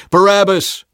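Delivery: high, loud shouted name; crowd shout. A narrator's reading. Crowd answering the governor, shouted back. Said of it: "The crowd shouted back".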